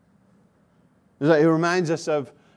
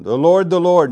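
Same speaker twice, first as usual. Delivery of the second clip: louder, ringing repetition; loud proclamation; 4 semitones higher, 9 dB louder than usual. Acted. Preacher returning to the text, with a loud proclaiming voice. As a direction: call it out like a herald. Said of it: "But we need to move on".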